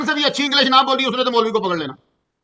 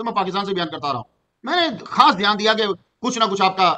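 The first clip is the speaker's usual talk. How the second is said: normal talking voice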